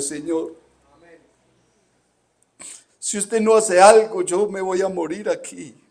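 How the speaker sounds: loud, high plea breaking out; desperate cry. Retold or imitated. Pastor relives begging God before the giant, loud desperate cry. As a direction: cry out to God as if about to die.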